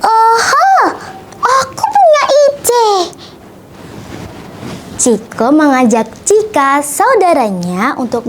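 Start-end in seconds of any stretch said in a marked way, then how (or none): 0.0-2.9 high, excited character voice; bright-idea exclamation. A narrator's reading. Ciko hitting on an idea, high and excited. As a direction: exclaim it high as inspiration strikes.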